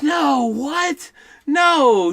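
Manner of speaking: high mock disbelief; high-pitched incredulous denial; shocked mother. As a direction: imitate a stunned mom, pitch up. Retells shocked mother on phone.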